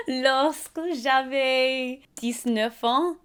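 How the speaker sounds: laughing through halting French, high bursts. Real, not acted. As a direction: giggle through the French words. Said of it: "Mon français est très mal".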